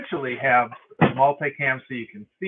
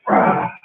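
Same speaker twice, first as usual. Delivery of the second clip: effort grunt; 7 dB louder than usual. Real, not acted. Effort during push-ups.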